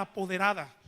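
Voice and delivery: ordinary talk, nothing marked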